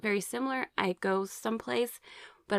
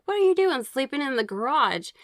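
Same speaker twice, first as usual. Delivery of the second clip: imitating an angry husband, higher and louder; a mad husband demanding answers at 2 a.m; retold angry outburst, pitched up and loud; 7 semitones higher, 5 dB louder than usual. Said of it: "but he was really mad".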